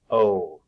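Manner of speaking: "O." said like the letter name; long o sound. Shown as a demonstration. Teacher showing children the long o, said like the letter. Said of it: "the long O sound is O".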